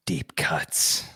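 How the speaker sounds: breathy hypnotist whisper. Pretend. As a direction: lull them under like a stage hypnotist. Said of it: "that was my, uh, hypnotist voice".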